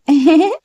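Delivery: short high giggle; a little girl laughing at her uncle; child's giggle. A narrator's reading. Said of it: "சொல்லிக்கொண்டே சிரிக்க". (சிறுமியின் சின்ன சிரிப்பு)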